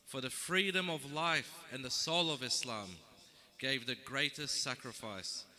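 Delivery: measured, declaimed verse with falling line ends; poem recitation. Acted. Poet reciting the story of Karbala to a gathering, solemn declamation. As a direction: recite it line by line, pausing after each phrase.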